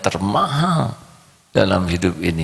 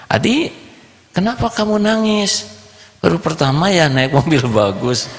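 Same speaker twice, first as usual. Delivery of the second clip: put-on high teasing kid voice; child's voice; 10 semitones higher than usual. Boy asks his friend why he cries, high child voice. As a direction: tease like a little boy.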